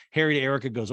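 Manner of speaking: ordinary talk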